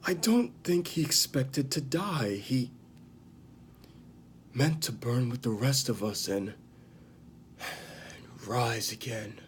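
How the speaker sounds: lightly acted grave confession, halting between phrases; acted Jaime Lannister. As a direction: recount the killing haltingly, with heavy pauses. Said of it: "I'm gonna perform it right now".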